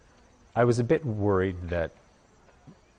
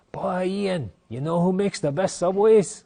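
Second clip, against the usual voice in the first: put-on much higher, louder Lenny from the reserve accent; Lenny's thick reserve accent; 11 semitones higher, 7 dB louder than usual. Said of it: "Lenny's accent was much thicker".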